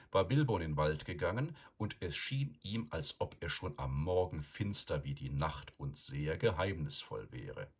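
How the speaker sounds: plain reading voice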